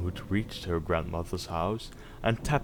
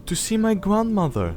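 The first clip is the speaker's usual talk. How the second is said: put-on much higher little girl voice; 13 semitones higher, 4 dB louder than usual. Girl tells the wolf where she's going, high child voice. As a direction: chirp it like a small child. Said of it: "said the child".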